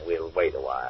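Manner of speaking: low, soft and settled; low-voiced and unhurried; calm decision. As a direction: say it quietly, a decision made. First take, calm decision.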